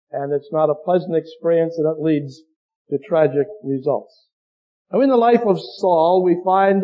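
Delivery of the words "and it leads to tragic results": ordinary talk, nothing marked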